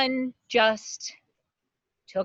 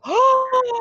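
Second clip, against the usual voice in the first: sharp high gasp of delight; excited gasp; 5 dB louder than usual. Acted. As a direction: gasp loudly like thrilled news. Excited gasp at fundraiser news.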